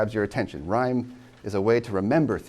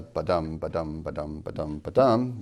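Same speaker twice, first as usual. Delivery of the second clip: ba-dum, ba-dum with each DUM stressed; iambic beat; 3 semitones lower than usual. Shown as a demonstration. Teacher demonstrates the regular meter, iambic beat. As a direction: chant ba-dum as weak then strong, evenly. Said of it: "which is iambic pentameter".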